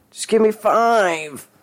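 very high, drawn-out begging; desperate plea. A narrator's reading. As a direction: plead up high, stretching it out. Denny begs dealer for time.

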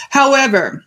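normal talking voice

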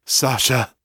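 lightly voiced telepathic cry for Sasha; Trace calling out. A narrator's reading. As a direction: call the name through a failing link, understated. Trace calls out through mental link.

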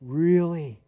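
mock astonished reaction; wide-eyed disbelief. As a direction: imitate someone taken aback. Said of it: "His eyes were like".

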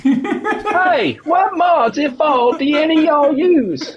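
very high, loud hammed-up Southern holler; mock southern accent. Co-host showing his friend how, exaggerated southern accent. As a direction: overdo a Southern accent that lands closer to cockney. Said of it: "Gotta do that in a more southern accent".

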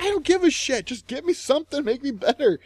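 high, loud mock yelled demand; angry demand. Demanding treatment from the nurse, a high loud mock yell. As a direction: shout it up high at her. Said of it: "I was like".

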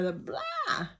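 high mocking squeak on blah. Real, not acted. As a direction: squeak it out dismissively. Mocking her dull self.

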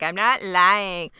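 drawn-out, unemotive mock denial; slow, oddly toneless protest; dramatic but emotionless. Boy denying it to his moms, dramatic yet emotionless. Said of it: "most dramatic, but also the least emotive way possible".

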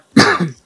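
single dry cough; coughing fit. Real, not acted. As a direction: cough once mid-sentence. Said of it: "primeira tosse do, do vídeo".

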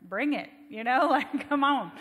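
loud, laughing eager challenge; fired-up churchgoer laughing at a bold rule; eager bring it. Retold or imitated. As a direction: fire it out like accepting a dare with a laugh. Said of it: "I was like".

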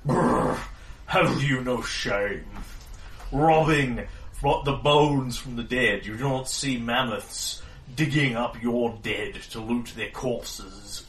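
slow, loud scolding beast; mammoth voice. Acted. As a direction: chide them like an old offended giant.

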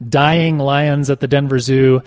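ordinary talk

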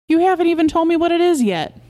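high, loud indignant protest; exasperated. Acted. Wife demands husband explain the jelly, exasperated.

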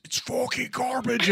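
high, strained mock scream; imitated scream. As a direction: mimic a screaming metal singer without full volume. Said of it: "And then he screams".

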